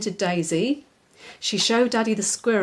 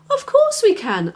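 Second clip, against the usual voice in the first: high, loud proud reply; a worm proud that it talks; 13 semitones higher, 5 dB louder than usual. Acted. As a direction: puff up with pride. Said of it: "answered the worm proudly".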